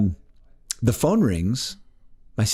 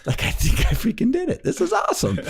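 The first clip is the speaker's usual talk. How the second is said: sing-song, much higher, giddy with success; thrilled. Retold or imitated. Amateur hypnotist thrilled his first try worked, giddy and high. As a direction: squeal it like you can't believe it worked.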